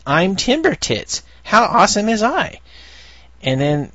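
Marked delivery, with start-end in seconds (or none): 0.1-2.6 a silly wooden puppet bragging; goofy character voice. Acted. Reading a comic panel aloud, higher goofy bragging puppet voice. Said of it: "And he says".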